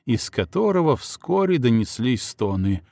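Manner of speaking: plain reading voice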